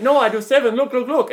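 high, loud broken-English impression; mock Vietnamese accent. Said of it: "trying to do the accent".

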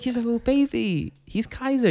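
high, babying imitation of mom; doting coo. Mom defending her baby puppy Kaiser, doting coo. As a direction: coo over the little puppy.

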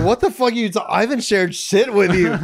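loud indignant disbelief; angry retort. Retold or imitated. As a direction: snap back, offended and loud. Retold outraged reply to coworker.

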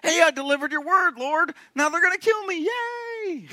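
hammed-up Elijah, rising on "gonna"; a touch of sarcastic Elijah to the Lord. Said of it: "Elijah's left kind of feeling like".

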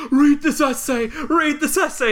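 high, loud desperate shouting; frantic yell. Retold or imitated. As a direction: yell it like a man in a panic. Dying man yells at missionary.

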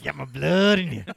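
loud, thick Southern caricature; over-the-top Louisiana witch doctor, Southern accent; creepy Southern accent. Retold or imitated. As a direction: exaggerate the creepy Southern twang. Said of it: "I don't know where this Southern accent came".

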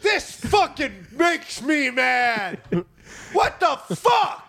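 high, slow shouting; fire yeller's outrage. Acted. Fire yeller ignoring the hose, shouting in outrage. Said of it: "the fire yeller shows up and he goes".

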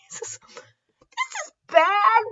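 laughing while speaking. Real, not acted. Laughing at the disturbing fanfic.

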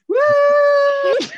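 sudden high loud whoop; mock kazoo whoop. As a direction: blast it like a kazoo mid-punchline. Imitating a kazoo heckle.

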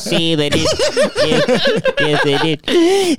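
high, slow laughing put-on client voice; mock client confessing. Client admitting to his lawyer, high laughing mock voice. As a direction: squeal the yeses through laughter. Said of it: "He goes".

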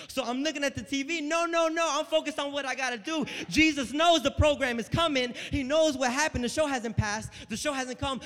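building full-force exhortation; impassioned preaching. Real, not acted. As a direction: preach it loud and insistent.

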